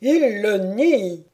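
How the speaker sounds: loud, very slow royal ruling; weighty pronouncement from the bench; pronouncing King. Acted. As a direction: draw it out like a verdict. King concludes from the denial.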